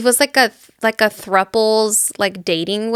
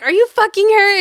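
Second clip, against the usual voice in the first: very high-pitched imitated accusation; mock incredulous demand; 10 semitones higher, 4 dB louder than usual. Rick on the phone, guessing at once, incredulous. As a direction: blurt the question high, as if catching him out.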